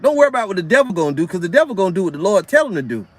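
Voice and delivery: usual speaking voice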